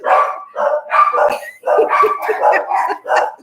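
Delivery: squeaky silent giggle; helpless high-pitched laughter at the dogs; high breathless laugh. Real, not acted. Laughing at the daughter's dogs barking at the door, breathless giggle.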